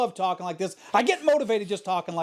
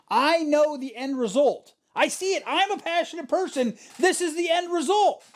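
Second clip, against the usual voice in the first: fired-up exclaiming; 8 semitones higher than usual. Real, not acted. Podcast host firing up listeners about passion, excited and high. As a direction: preach it to the crowd, rising.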